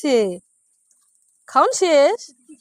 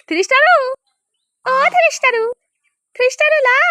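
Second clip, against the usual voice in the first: high, louder coaxing call of the name; sing-song call; 8 semitones higher, 4 dB louder than usual. Real, not acted. Calling the cow Three Star, high sing-song call.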